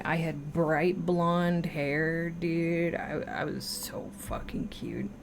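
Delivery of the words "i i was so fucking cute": whisper. Real, not acted. Whispered boast about himself.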